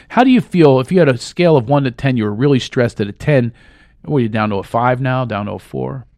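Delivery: normal talking voice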